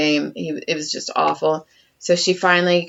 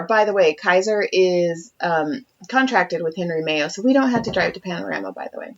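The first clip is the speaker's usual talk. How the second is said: ordinary talk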